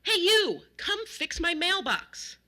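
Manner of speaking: high, loud demanding yell; slow, loud, high holler; angry shouting. A narrator's reading. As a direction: shout an order at the neighbor. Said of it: "was shouting at James".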